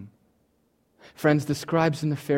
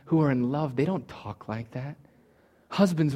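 ordinary talk

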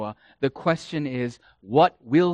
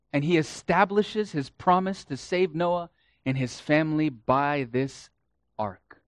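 ordinary talk, nothing marked

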